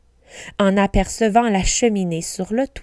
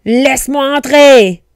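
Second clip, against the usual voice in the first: loud, raised angry demand; forceful, drawn storybook command; 4 semitones higher, 9 dB louder than usual. A narrator's reading. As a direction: shout it as the furious wolf. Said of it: "répéta le loup, très en colère".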